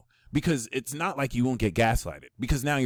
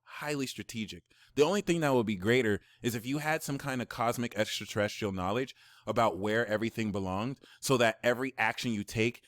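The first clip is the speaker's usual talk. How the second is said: ordinary delivery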